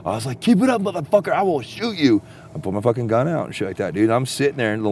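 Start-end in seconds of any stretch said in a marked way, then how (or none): 0.4-2.2 high-pitched threatening holler; nervous bluster turned to yelling; retold yelling. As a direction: yell a warning at whoever is outside. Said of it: "I started just yelling".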